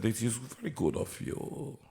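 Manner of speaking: mock Russian accent; casting director thanking the singer, in a mild Russian accent. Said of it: "suddenly become Russian".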